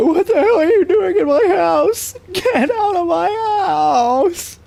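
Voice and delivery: shrill, high, drawn-out scream; hammed-up terrified yelling; screaming homeowner. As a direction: scream at the intruder. Acted skit gone wrong.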